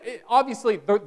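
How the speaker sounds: ordinary talk, nothing marked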